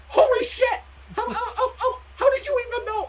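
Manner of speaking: high, stunned laughing shriek; can't believe what she's seeing; shocked disbelief. Retold or imitated. Telling of first date, wife's shocked high cry. Julianne shocked at same birthday.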